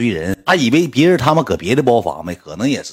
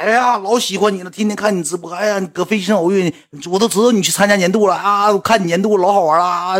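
high gushing fan voice; 9 semitones higher than usual. Retold or imitated. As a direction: gush like a starstruck fan. 粉丝抱着他夸. (高音热情粉丝夸个不停)

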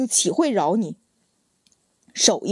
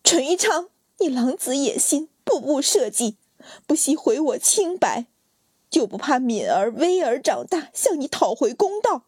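high, raised accusing cry; furious yell; 6 semitones higher than usual. Acted. Wife yelling at her scheming husband, high and furious. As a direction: hurl it at him with rage. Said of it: "咬牙吼道".

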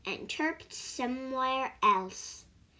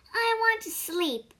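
sleepy mouse plea; 10 semitones higher, 4 dB louder than usual. Acted. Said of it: "said the mouse".

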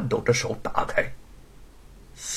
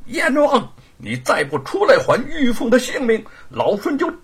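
higher, louder, raging threat; furious shout; 14 semitones higher, 6 dB louder than usual. Acted. Monkey King threatening the King of Hell in a rage. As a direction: bellow like he'll smash the hall. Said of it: "满腔怒火无处发泄".

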